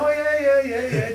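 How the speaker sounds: high-pitched run of mock agreement; high voice trailing down as he agrees; eager agreeing impression. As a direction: imitate someone rattling off keen yeses, high. Imitated man agreeing on prank call.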